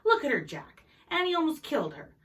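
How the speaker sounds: loud, high and breaking; outraged mother near tears; voice breaking in outrage. A narrator's reading. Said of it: "Her voice broke".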